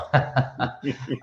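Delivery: short chuckle at own remark; self-amused chuckle; laugh. Real, not acted. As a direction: laugh lightly at yourself.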